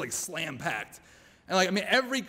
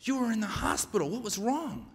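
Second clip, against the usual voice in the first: put-on higher-pitched little child's question; seven-year-old daughter; 5 semitones higher, 4 dB quieter than usual. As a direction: lightly voice a concerned young daughter. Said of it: "my seven-year-old leans up".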